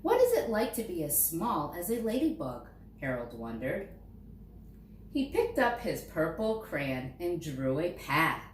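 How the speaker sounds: ordinary delivery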